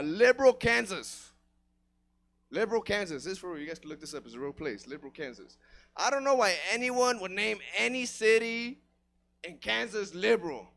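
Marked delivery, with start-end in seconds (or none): none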